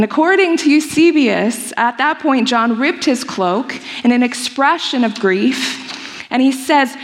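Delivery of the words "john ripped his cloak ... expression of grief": usual speaking voice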